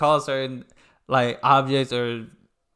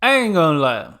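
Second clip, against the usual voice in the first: loud admission; 2 semitones higher, 8 dB louder than usual. Real, not acted. Reacting loudly to clip.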